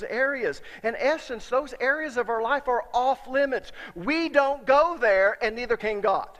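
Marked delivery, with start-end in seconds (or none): 3.9-5.4 slow, loud, pounding each word; preacher warning the congregation about denial; emphatic preaching. Acted. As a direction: drive it home slowly.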